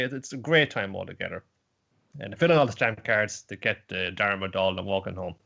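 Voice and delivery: usual speaking voice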